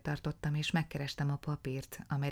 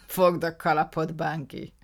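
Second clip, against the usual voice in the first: laughing, louder taunt; 8 dB louder than usual. A narrator's reading. Will mocks the detective while laughing. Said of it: "mondta röhögve".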